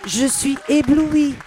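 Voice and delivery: loud, slow, drawn-out gush of wonder; dazzled. Real, not acted. Moved by meeting Paloma, she gushes loudly and slowly. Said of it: "ce petit moment de grâce".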